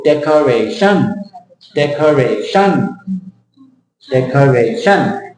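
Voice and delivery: decoration slowly, every syllable full, weight on the end; wrong Thai-style stress. Shown as a demonstration. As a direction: give decoration equal beats with a drawn-out -tion. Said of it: "Thai people".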